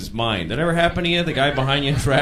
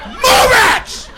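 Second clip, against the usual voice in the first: very high, loud furious driver yell; screaming; 7 dB louder, much slower than usual. Retold or imitated. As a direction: scream the order at the car in front. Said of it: "starts screaming at me".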